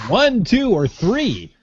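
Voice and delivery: high, swooping game-show count; sing-song offer. Real, not acted. Co-host playfully sums up the prize choices, sing-song.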